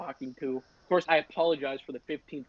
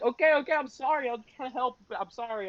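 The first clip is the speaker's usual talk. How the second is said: high-pitched, placating retold apology; flustered apology; 7 semitones higher than usual. To the angry band kid, mock flustered. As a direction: imitate yourself backing off under attack.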